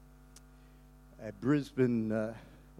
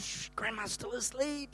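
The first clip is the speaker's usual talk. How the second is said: hushing; 3 semitones higher, 8 dB quieter than usual. Retold or imitated. Hushing grandkids, grandma asleep.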